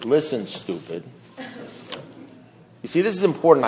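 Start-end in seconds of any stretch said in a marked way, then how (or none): 0.0-1.0 low, soft, slow sponsor voice; imitating an unruffled sponsor; sponsor's put-down. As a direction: insult him quietly, taking your time. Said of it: "At which point he said".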